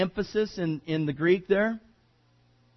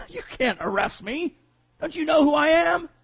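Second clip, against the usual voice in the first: mock indignant VIP, the last question pitched up high; entitled big shot; 7 semitones higher than usual. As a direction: play the self-important man scoffing at arrest. Entitled person caught by police.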